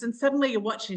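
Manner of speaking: usual speaking voice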